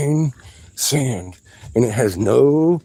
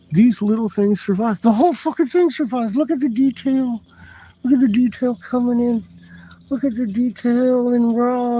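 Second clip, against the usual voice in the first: thrilled; 10 semitones higher than usual. Real, not acted. Digger showing off his find, high and thrilled. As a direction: pitch way up, talking fast and breathless with awe.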